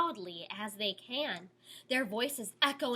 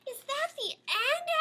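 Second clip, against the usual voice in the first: put-on child. Child voice in story frame.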